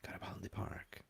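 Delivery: whispered, hushed name. Acted. Host echoing the guest's place name in a whisper. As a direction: breathe the name like a secret.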